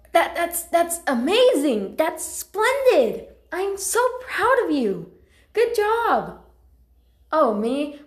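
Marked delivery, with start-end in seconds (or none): 0.1-6.4 high, drawn-out, delighted congratulations; thrilled praise. Acted. House cat congratulating Alex on being leader, thrilled praise. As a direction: gush with pride, voice pitched right up.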